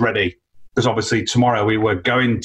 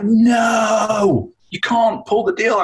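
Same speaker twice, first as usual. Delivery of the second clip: high, drawn-out "no" in mock anguish; dismayed protest; 11 semitones higher, much slower than usual. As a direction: cry out in protest as the deal collapses. Retelling his dismayed protest at lost deal.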